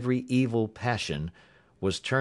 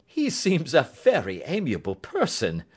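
lilting, higher-pitched irony; amused, laughing; 6 semitones higher, 3 dB louder than usual. Acted. Holmes remarks on the departed visitor, amused. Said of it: "said Holmes, laughing".

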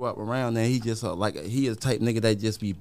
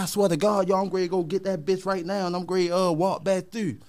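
friend's voice pitched higher, insisting; imitating the friend; 8 semitones higher than usual. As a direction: lightly play the friend promising to fetch it. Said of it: "he like".

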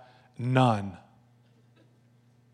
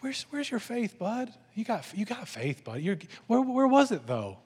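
high, soft, coddling voice; hammed-up sweetness, as if to a little buddy; gentle Jesus voice; 7 semitones higher, 3 dB quieter than usual. Bible-story Jesus asking disciples about faith, mock gentle, high and soft. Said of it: "tone in Jesus' vo-, voice".

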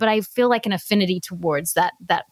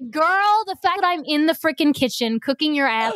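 mock-indignant mother; 7 semitones higher than usual. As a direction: act out an exasperated mom scolding her kid. Mother acts out scolding her daughter.

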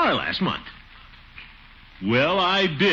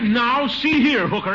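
indignant protest; 8 semitones higher, 5 dB louder, slower than usual. Acted. Gildy offended by judge's joke.

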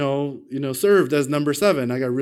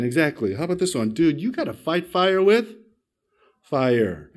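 normal talking voice

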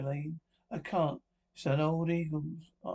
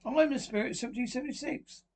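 high, quick spook voice; put-on high spirit voice; mock spirit; 7 semitones higher, faster than usual. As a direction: pose as a ghost to tease the séance. Guest mocks séance as a spirit.

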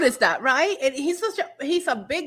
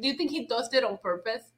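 usual speaking voice

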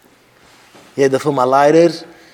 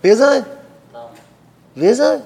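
short, high, loud question; insistent prompting; 9 semitones higher, 5 dB louder, faster than usual. Real, not acted. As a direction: press for an answer with a sharp rise. Pressing the listeners for an answer.

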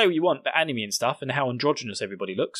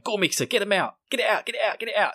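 higher-pitched mock urging; rapid, insistent repeated demand, pitched up; Eren; 7 semitones higher, faster than usual. Eren pressing Mikasa, imitated in a high urgent voice. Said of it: "then Eren starts going".

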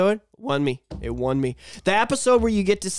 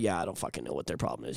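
low grumbling friend; 14 semitones lower, 14 dB quieter, faster than usual. Retold or imitated. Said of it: "They're like".

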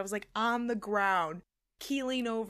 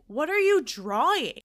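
mock-baffled demand, pitch raised; louder, bewildered question; 4 semitones higher, 6 dB louder than usual. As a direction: ask it like you can't believe the scribble. Baffled retort to dad's scribbles.

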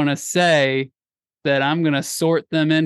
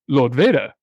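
British officer addressing his dark lord; British imperial officer; 3 semitones lower than usual. Acted. Host demonstrating British accents, imperial officer. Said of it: "there's the kind of the imperial, um, officer".

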